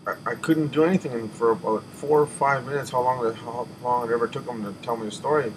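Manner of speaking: normal talking voice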